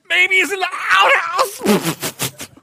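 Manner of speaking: very high, loud, slow, breaking into laughter; laughing shout. Retold or imitated. Preacher delivering Elijah's outhouse joke, loud and laughing. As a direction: yell the punchline while cracking up. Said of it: "He says".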